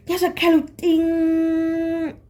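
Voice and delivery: slow, high held ting; imitated ringing. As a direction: sing out the ear-ringing tone. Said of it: "something is buzzing in my ear".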